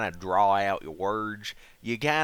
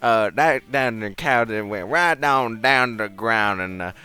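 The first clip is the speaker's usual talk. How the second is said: put-on Southern accent. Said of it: "give me your best Southern accent".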